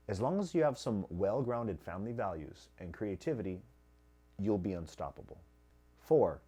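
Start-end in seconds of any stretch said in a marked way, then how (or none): none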